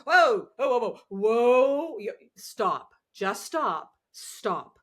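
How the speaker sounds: loud, drawn-out whoa, then firm stops; calling a halt. Retold or imitated. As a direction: pull someone up short. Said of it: "and then it was like".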